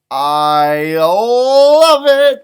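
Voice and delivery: very slow, loud, drawn-out joy; delighted. Real, not acted. Reader to the kids about the party, delighted. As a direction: savour the happy ending.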